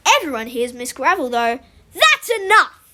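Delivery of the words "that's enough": loud, higher-pitched command; strict teacher's shout. Acted. Teacher silencing rowdy pupils, strict shout. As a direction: cut through the noise sternly.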